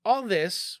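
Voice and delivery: usual speaking voice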